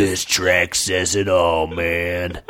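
low, slow demon drawl; lightly voiced cool demon; demon skull voice. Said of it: "said one demon skull".

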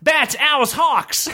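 high shouted call; high shouted command; shouted summons. Acted. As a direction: shout them in one by one, high. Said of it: "shouted the Beastmaster".

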